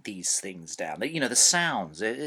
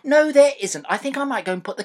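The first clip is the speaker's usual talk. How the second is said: exasperated; 12 semitones higher, 10 dB louder, faster than usual. Real, not acted. Dad at end of tether.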